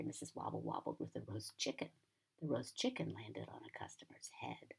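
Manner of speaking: usual speaking voice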